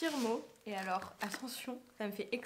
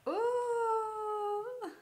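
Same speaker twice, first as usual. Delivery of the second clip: surprised exclamation; 12 semitones higher, 7 dB louder than usual. Real, not acted. Surprised on seeing the glasses.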